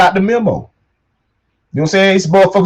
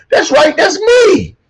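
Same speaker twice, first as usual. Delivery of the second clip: excited; 8 semitones higher, slower than usual. Real, not acted. Pointing at his own picture.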